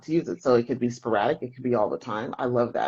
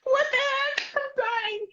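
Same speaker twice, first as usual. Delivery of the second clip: high squeal through laughter; What the heck through giggles; laughing disbelief; slower than usual. Real, not acted.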